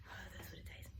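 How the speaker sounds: sigh, then breathe the words out softly; hushed nostalgia for the old days; wistful whispered sigh. Acted. Boy remembering film photos, whispered wistful sigh.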